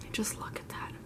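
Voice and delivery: hushed whisper of admiration; breathless whispered awe; awed whisper. Real, not acted. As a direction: whisper it like you can't believe how pretty it is.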